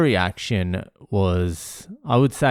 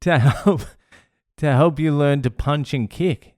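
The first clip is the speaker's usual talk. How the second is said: higher-pitched exasperated scolding; retold yelling; 6 semitones higher than usual. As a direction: drive the point home like an annoyed dad. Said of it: "and I'm yelling".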